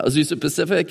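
ordinary talk

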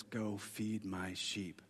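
slow, soft and tender; Jesus quietly sends Peter, soft and slow; gentle commission. Acted. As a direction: hand him the task kindly. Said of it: "Then Jesus says".

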